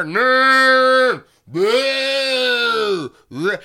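long, loud, high-pitched moan; imitated moaning. Man pretending to be a screamer, mock moaning. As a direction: wail it out on one held high note. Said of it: "He's, like, moaning".

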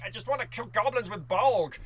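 high, loud cry of frustration; frustrated outburst. Retold or imitated. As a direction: let it burst out like a gamer who finally snaps. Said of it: "But I'm like".

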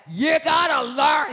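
loud, drawn-out pastor imitation; big booming voice. Imitating her pastor from the pulpit, big booming voice. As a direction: boom it out like an old pastor. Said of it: "big booming voice".

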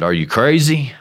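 incredulous. Retold or imitated. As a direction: retort with mock astonishment.

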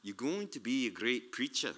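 lightly imitated Scottish accent; broad Scottish accent. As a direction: quote her with a hint of broad Scots. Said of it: "in a broad Scottish accent".

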